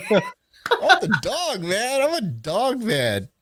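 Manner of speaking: high, hammed-up insistence; mock pleading. Storyteller demands the dog from his friend, high mock protest.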